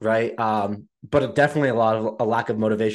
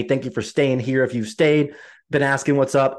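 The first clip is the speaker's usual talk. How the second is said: usual speaking voice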